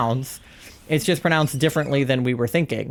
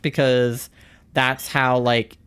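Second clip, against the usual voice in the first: usual speaking voice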